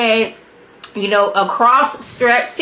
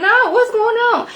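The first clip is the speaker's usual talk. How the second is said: shocked disbelief; 14 semitones higher than usual. Retold or imitated. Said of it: "I'm like".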